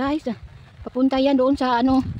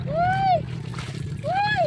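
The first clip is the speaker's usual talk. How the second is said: excited yelp. Real, not acted. Calling out in the river.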